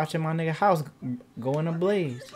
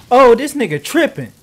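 high, loud yelp of disbelief; startled shout; 10 semitones higher, 13 dB louder than usual. Real, not acted. Gamer yelling at his sim in shock. Sim acts crazy in game.

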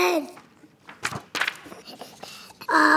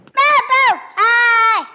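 loud excited yell; shouting; 6 semitones higher, 9 dB louder, much slower than usual. Real, not acted. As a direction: blurt it out at full voice. Kid suddenly yells out.

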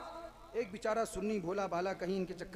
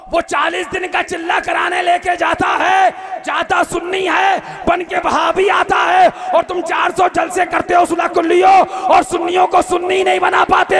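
high, loud, sustained exhortation; full-force preaching; 11 semitones higher, 21 dB louder than usual. Real, not acted. Preacher reproaches his fellow Sunnis, at full force.

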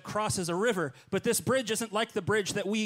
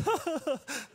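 nervous high-pitched giggle; sheepish high titter; awkward high giggle; 11 semitones higher than usual. Retold or imitated. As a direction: let out a small embarrassed laugh. Awkward laugh after greeting.

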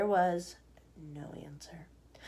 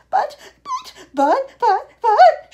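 high, loud, spluttering stammer; shrieking; 16 dB louder, much slower than usual. A narrator's reading. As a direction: splutter in shrill panic. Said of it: "shrieked Missus Salt".

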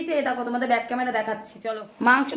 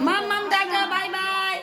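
sing-song goodbye; 7 semitones higher, slower than usual. Real, not acted. Seeing the toddler off, sing-song high goodbye. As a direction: call bye-bye sweetly to a little one.

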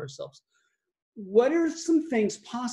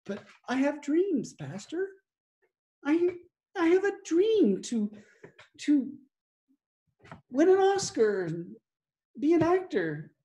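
dreamy churchgoer; 7 semitones higher than usual. Retold or imitated. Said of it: "we have some things where we say".

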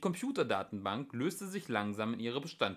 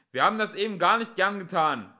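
high, loud apology; shouted police excuse; shouting; 8 semitones higher, 10 dB louder than usual. A narrator's reading. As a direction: yell your regrets across. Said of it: "schrie der andere Polizist".

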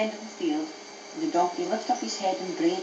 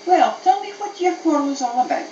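friendly donkey; 6 semitones higher, 3 dB louder than usual. Acted. Donkey voiced by narrator.

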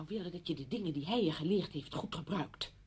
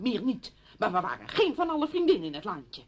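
loud, emphatic rebuttal; heated, forceful protest; angry denial; 3 semitones higher, 7 dB louder than usual. Acted. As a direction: insist on it with raised voice. Friend denies the friendship angrily.

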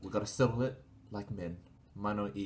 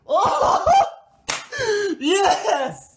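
shrieking with laughing joy; triumphant cheering; 16 dB louder than usual. Real, not acted. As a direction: yell out the win, laughing. Winning rock paper scissors.

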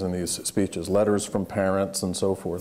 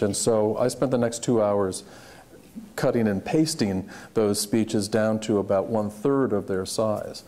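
usual speaking voice

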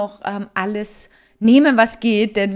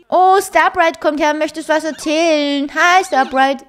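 cooing at a horse; 7 semitones higher, 7 dB louder, slower than usual. Real, not acted. Greeting the horse. (hohe, langsame Babysprache für das Pferd)

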